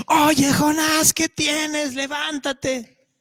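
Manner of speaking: captain calling the sleeping Jonah awake; high slow calling voice. Acted. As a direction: call him slowly out of sleep. Dramatized wake-up call to Jonah.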